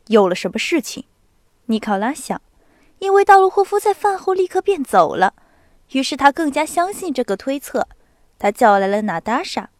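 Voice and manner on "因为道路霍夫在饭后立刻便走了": ordinary delivery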